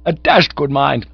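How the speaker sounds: high, loud, offended; indignant insistence. A narrator's reading. Cyril insisting to the angry manager, high indignation. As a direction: repeat the threat in a huff. Cyril repeats his threat to Blumenfield.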